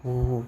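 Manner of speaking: drawn-out "woo" for a swinging blade; vocal whoosh of a sword stroke; imitated sword whoosh. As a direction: make the sword's swish with your voice. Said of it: "sound आती है तलवार की".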